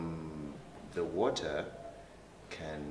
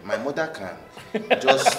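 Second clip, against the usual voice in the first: Nigerian accent; 9 semitones higher, 14 dB louder than usual. Shown as a demonstration. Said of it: "let me speak in my Nigerian".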